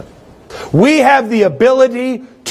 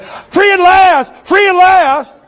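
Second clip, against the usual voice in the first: much higher, loud, slow triumphant cry; mock newborn shout; 12 semitones higher, 3 dB louder, slower than usual. Preacher jokes about a baby being born, loud high shout. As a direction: belt it like a baby freed from the womb. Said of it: "popped out of your mama and went".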